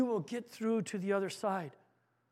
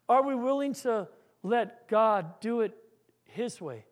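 ordinary talk, nothing marked

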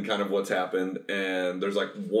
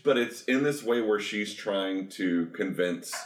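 normal talking voice

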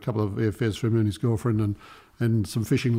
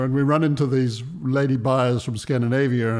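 usual speaking voice

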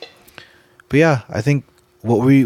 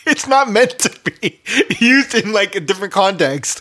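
laughing while talking; 9 semitones higher, 4 dB louder than usual. Real, not acted.

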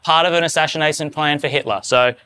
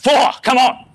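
awed exclamation; 7 semitones higher than usual. Retold or imitated. Awed reaction to quote.

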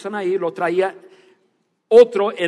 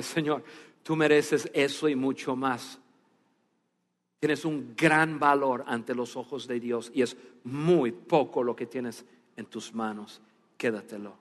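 the speaker gently pressing the gift on the weeping man; tender reassurance; 7 semitones lower, 6 dB quieter than usual. Retold or imitated. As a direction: comfort him gently, let it sink in.

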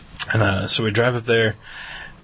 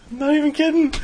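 high, laughing protest. Real, not acted. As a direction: laugh while insisting it's true. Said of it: "laughing through it".

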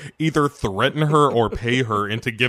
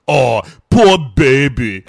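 put-on slow, louder Austrian-accented taunt; Arnold impression; 9 dB louder, slower than usual. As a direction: sneer it in Schwarzenegger's Austrian accent. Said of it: "Arnold Schwarzenegger ... says".

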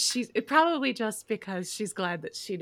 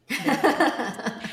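louder, higher, laughing through it; laughing repeat; 6 semitones higher, 7 dB louder than usual. Real, not acted. Owning up to the camel prank, laughing loudly. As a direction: crack up on the punchline.

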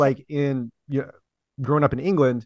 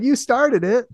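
higher-pitched mock accusation; retold comeback; 9 semitones higher, 3 dB louder, slower than usual. Accent copier answering angry people, mock indignant. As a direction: quote her protest, pitched up. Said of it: "she'll be like".

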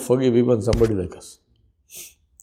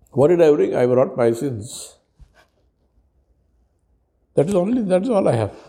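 raised, earnest supplicant voice; humble plea; 4 semitones higher than usual. Acted. Preacher voicing his plea to his Lord. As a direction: plead with your Lord, humbly. Acted plea to Allah on Judgment.

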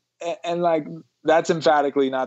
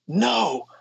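angry child impression; 8 semitones higher than usual. Dad imitating his eight-year-old angry at his brother, high mock tantrum. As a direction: imitate a furious little kid digging in. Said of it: "he was so angry at his brother".

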